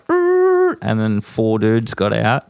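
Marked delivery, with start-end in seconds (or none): 0.1-0.8 high revving engine imitation; mimicking a car pulling up fast; car engine noise. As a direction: rev it like a car screeching up. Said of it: "and it was like".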